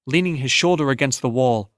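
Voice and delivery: plain reading voice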